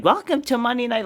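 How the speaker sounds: high parody voice; mocking catchphrase. Vlogger mocks cheesy wrestler intros, lightly high. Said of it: "coming out and saying".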